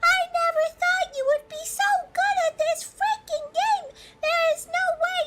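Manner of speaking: high whiny crying voice; mock whiny voice. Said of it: "Sophia was like".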